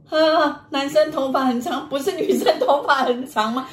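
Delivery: high-pitched startled questioning; mock alarm. Husband hearing of a long-haired ghost, mock alarm.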